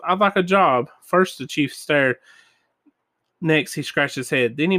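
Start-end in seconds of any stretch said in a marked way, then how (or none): none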